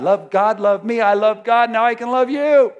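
preaching build. Acted. Preaching climax on love.

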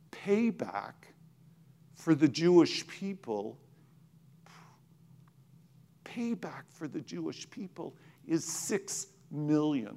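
hushed divine voice. Retold or imitated. The Lord speaks to him.

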